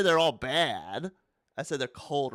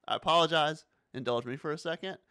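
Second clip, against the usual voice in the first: usual speaking voice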